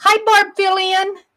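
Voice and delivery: ordinary talk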